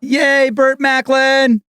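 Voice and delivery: high, drawn-out whoop of delight; excited cheer. Real, not acted. Co-host hearing about FBI agent Burt Macklin, cheering. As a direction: cheer the name out high and slow.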